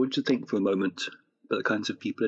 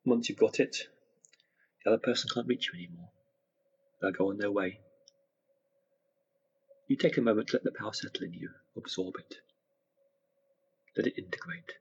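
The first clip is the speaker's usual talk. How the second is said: guided meditation; 4 dB quieter than usual. Acted. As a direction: gently guide them into relaxation.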